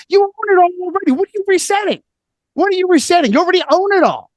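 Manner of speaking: high-pitched, exasperated mock protest; incredulous challenge. Guest challenging those behind the great reset, high and incredulous. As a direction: pitch it way up in disbelief.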